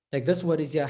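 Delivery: ordinary talk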